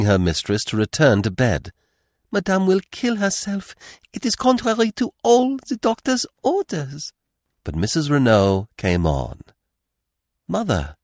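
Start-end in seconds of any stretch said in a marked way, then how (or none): 2.3-7.1 very high, pleading French maid; weeping, imploring maid. A narrator's reading. French maid begging her mistress back to bed, imploring. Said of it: "The French girl was weeping and imploring". 10.5-11.0 higher cry, dropping away; startled cry. A narrator's reading. Jack starting toward his mother, crying out. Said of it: "cried Jack, starting forward".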